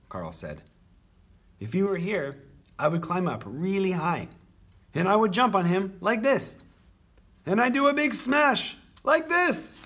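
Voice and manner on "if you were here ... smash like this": high voice building to a loud shout; worked-up boast. A narrator's reading. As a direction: build up to the big smash, louder and higher each time. Carl acts out jumping on Michael.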